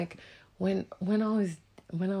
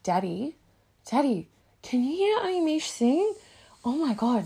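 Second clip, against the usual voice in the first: high, loud excited calling for Daddy; excited child calling; 9 semitones higher, 8 dB louder than usual. Retold or imitated. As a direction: call out to Daddy, thrilled. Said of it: "She's like".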